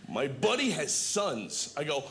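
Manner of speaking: ordinary delivery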